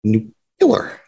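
nuclear said NOO-kyuh-ler; mock Bush saying nuclear as nucular. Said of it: "not knowing how to say nuclear".

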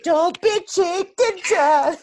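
loud, very high, drawn-out squeal; piercing put-on falsetto call; high-pitched impression. High-voiced character impression after whistle impression.

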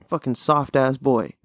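ordinary talk, nothing marked

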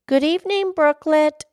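high, loud, courteous greeting; high-pitched well-mannered lass; polite little girl. Acted. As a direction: greet brightly in a high child's voice. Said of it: "she said politely".

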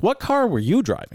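high-pitched, sceptical questioning; retold challenge. Racer retelling how he questioned the stranger, sceptical challenge. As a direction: act out grilling someone, pitch up.

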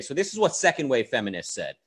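usual speaking voice

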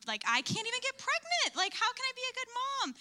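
high-pitched retold complaint; mock wailing protest. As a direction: push it way up into an exasperated whine. Retold tearful protest to the prophet.

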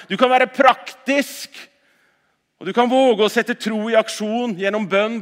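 loud, high ringing preacher's call; forceful preaching. Acted. Pastor rousing the congregation to go out, forceful preaching. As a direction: proclaim it to the whole hall.

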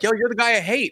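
lightly voiced cosplayer; mock fan voice. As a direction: tease him as a fan would. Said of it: "they're like".

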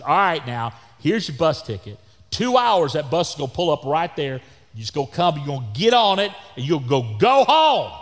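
loud, high rant building in exasperation; agitated scolding. Retold or imitated. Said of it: "I'm getting more agitated".

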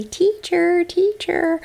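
put-on high, drawn-out child's call; imitating a small pupil's high whine for attention; little kid pleading. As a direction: tug at the teacher like a needy little one. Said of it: "pull at you and tug and say".

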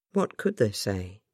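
ordinary delivery

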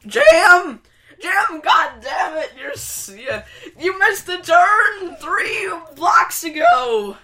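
high, yelling impression of Judy scolding Jim; nagging backseat driver. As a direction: shout at the driver who missed the turn. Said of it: "Do a Judy impersonation".

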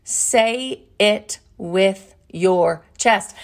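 each word drawn out and weighty; slow, deliberate reading. Shown as a demonstration. Host reveals her cliff note, slow and deliberate. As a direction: spell it out word by word, very slowly.

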